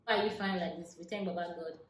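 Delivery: normal talking voice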